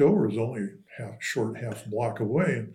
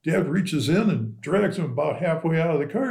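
normal talking voice

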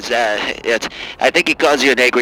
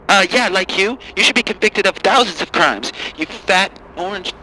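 angry liberal caller; 6 semitones higher than usual. Acted. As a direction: sneer it up high. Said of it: "Angry Lib".

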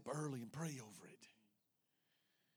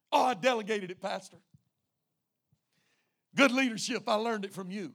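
raised, loud self-assured reply; breezy excuse; 6 semitones higher, 13 dB louder than usual. Acted. Member excusing himself to the pastor, loud and breezy. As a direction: brush it off proudly.

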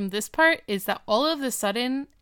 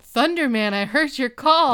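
loud, higher, swooping call-out; mock heroic call; 4 semitones higher, 6 dB louder than usual. Co-host echoes the super nerd's greeting, loud mock call. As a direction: belt it out like a nerd hailing a hero.

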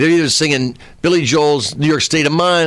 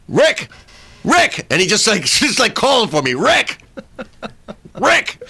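calling out; 9 semitones higher than usual. Retold or imitated. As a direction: call him over the crowd. Calling for the comedian.

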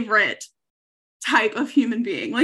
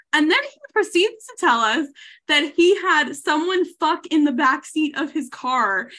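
ordinary talk

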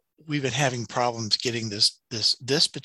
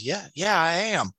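put-on Shreveport accent; 6 semitones higher than usual. Consultant answers the customer, in an imitated Shreveport accent. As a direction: mimic the Southern consultant, higher than usual. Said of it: "it is that Shreveport accent".

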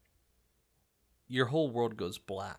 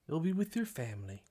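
hushed luring voice, higher; soft creepy voice; 5 semitones higher, 3 dB quieter than usual. Acted. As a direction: tempt her softly. GM voices tempter to Muffin.

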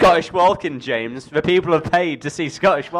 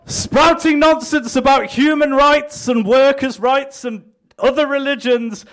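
lightly raised, hammed-up politician's pitch; mock campaign speech; 8 semitones higher than usual. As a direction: sell it like a smug candidate at a rally.

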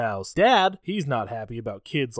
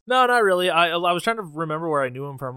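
normal talking voice

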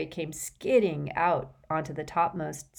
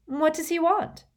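Hermione voice; 12 semitones higher, 4 dB louder than usual. A narrator's reading. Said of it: "Hermione said at once".

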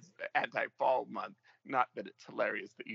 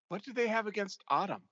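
puzzled; 8 semitones higher than usual. Retold or imitated. Retold puzzled thought.

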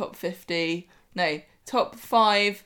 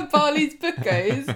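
higher, laughing and slow; amused echo of a funny slip; laughing question; 8 semitones higher, 8 dB louder, slower than usual. Real, not acted. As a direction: laugh the name back in disbelief.

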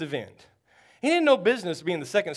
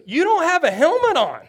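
exasperated yell; 9 semitones higher, 7 dB louder than usual. Retold or imitated. Coach yells at helmetless player.

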